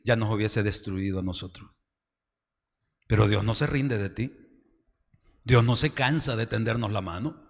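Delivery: ordinary talk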